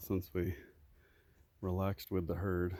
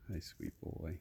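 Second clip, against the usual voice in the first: softer, breathy whispered hello; 4 semitones lower, 8 dB quieter, slower than usual. Real, not acted. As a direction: whisper it close to his ear. Whispering to a donkey.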